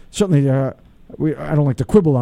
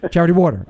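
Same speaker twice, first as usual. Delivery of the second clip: WATER stressed over a quicker charity; faster than usual. Shown as a demonstration. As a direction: punch the second word, water. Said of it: "emphasis on the water".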